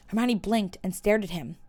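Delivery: ordinary delivery, nothing marked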